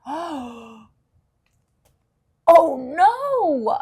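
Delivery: rising to a loud, high no; acted cry of dismay. Charlie Brown falling flat on his back, loud dismay. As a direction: realise too late you're falling.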